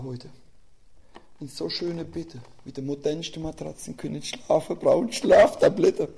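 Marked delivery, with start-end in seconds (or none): none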